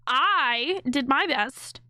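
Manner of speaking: slow, dragged "I did my best" with heavy "I"; stress on I. Retold or imitated. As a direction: mimic his smug "I", slowly. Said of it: "That tone right there".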